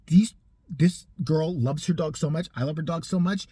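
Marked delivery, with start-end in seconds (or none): none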